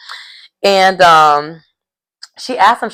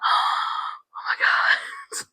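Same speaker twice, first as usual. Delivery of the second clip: breathless whispered oh my God, very high; gasping shock; 9 dB quieter, much slower than usual. Retold or imitated. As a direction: gasp it out, stunned.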